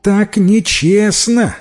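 high, drawn-out cry of protest; exclaimed protest. A narrator's reading. Wife protesting to the emperor, high indignant exclamation. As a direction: object loudly, stretching the words. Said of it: "воскликнула Юань Мэй".

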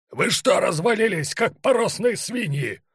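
high, loud angry yell; furious tirade. A narrator's reading. Colonel pouncing on lounging Cossacks, furious shouting. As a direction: lay into them at full voice. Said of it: "налетел он на лежавших".

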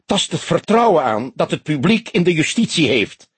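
high, indignant outburst; narrated angry chief, pitched high; mild shout. A narrator's reading. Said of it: "schreeuwde hij".

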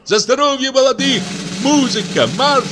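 shouting a toast to cover the scandal. A narrator's reading. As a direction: drown out the quarrel with cheer. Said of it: "Кричит".

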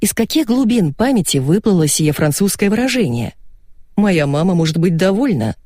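plain reading voice

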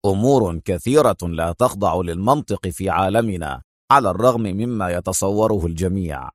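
low male voice with a slight smile; deep, mildly amused reply; smiling. A narrator's reading. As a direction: explain calmly in a deep register. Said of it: "ابتسم وهو يقول".

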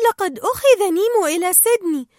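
high, loud, breathless urgency; Dory explaining. Acted. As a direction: rush to ask the little fish for help. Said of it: "استكملت دوري". (دوري تشرح بإلحاح وبصوت عالٍ)

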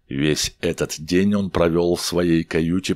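ordinary delivery, nothing marked

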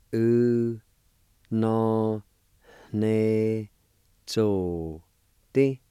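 U, no, ne, zo, te broken into separate syllables. Shown as a demonstration. Teacher modelling the word for gymnasium. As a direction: sound out each syllable. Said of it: "Sounds like this".

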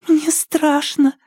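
put-on a scared small girl whimpering it; frightened little girl. A narrator's reading. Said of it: "пролепетала Диди".

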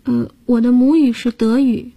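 normal talking voice

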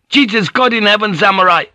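high, loud exclamation of alarm; startled cry. A narrator's reading. Drunk sailor suddenly spotting samurai, startled cry.

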